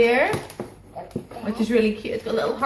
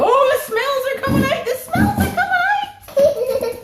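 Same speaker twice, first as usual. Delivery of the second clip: voicing the dogs; 4 dB louder than usual. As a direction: squeal it like an excited puppy. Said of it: "they go".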